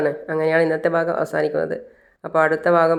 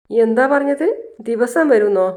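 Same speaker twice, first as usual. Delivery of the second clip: surprised; 6 semitones higher than usual. Acted. Mother startled at daily visits.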